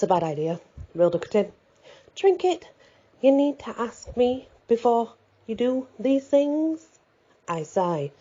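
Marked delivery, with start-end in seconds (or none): 2.1-6.9 put-on older woman voice. A narrator's reading. Rhoda scolds, older woman voice.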